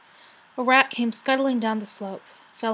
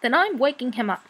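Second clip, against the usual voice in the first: usual speaking voice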